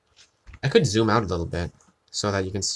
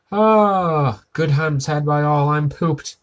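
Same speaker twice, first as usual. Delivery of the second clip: mock Superintendent Chalmers, raised and loud; high, loud Chalmers quote; 5 semitones higher, 5 dB louder, slower than usual. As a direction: sigh it out like a pompous boss, pitched high. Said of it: "And he says something else".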